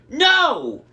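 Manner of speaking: high, loud scream of no. A narrator's reading. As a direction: shriek it in panic. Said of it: "Cersei screamed".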